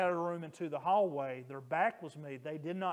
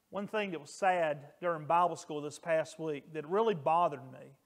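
usual speaking voice

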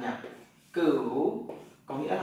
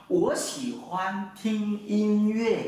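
slow, careful Mandarin, word by word; teacher modeling Mandarin slowly for students; slow Mandarin model sentence; 5 semitones higher, much slower than usual. Shown as a demonstration. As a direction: say it slowly for learners to repeat. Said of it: "Tôi thích nghe nhạc".

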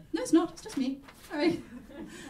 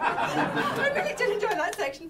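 laughing; 12 semitones higher than usual. Real, not acted. Host laughing at own joke.

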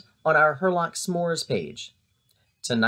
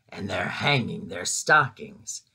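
snarled with a sneer; 7 semitones lower, 3 dB quieter than usual. A narrator's reading. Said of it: "he snarled with a sneer".

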